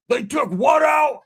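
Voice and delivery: slow, high, loud disbelief; imitated shocked yell; outraged shout. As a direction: holler it like a furious patient. Enraged friend shouts in disbelief.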